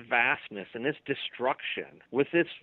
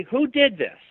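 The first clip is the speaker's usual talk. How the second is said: demanding; 10 semitones higher, 6 dB louder than usual. Pretend. Victim demands answers from investigators.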